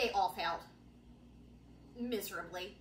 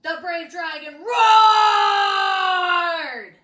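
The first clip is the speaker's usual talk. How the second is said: much louder, very slow roaring shout; roared line; 2 semitones higher, 15 dB louder, much slower than usual. Acted. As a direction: roar the words like the dragon. Roaring as read aloud.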